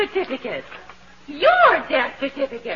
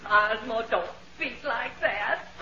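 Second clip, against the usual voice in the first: mock sobbing; 5 semitones lower, 7 dB quieter than usual. Wife mock-grieving.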